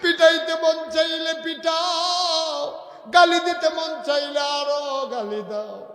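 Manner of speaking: acted anguished supplication; desperate begging wail. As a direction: cry out for mercy at full force. Umar begs the woman's forgiveness.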